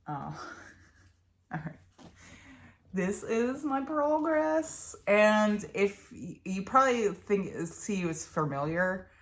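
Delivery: normal talking voice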